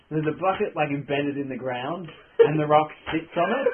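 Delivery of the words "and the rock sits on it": laughing through the words; giggling as you tell it. Real, not acted. Laughing while narrating.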